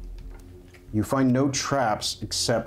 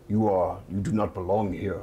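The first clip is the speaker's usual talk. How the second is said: low, stern Githyanki voice; solemn, lower warrior voice; Gnias; 6 semitones lower than usual. Acted. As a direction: pronounce judgement on an outsider, pitched down. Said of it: "Gnias looks at him and is like".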